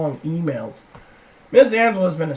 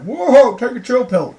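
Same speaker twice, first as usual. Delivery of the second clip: higher, louder imitated warning; mock exclamation; 7 semitones higher, 7 dB louder, slower than usual. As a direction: imitate him telling her to calm down, half shouted. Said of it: "Aaron's like".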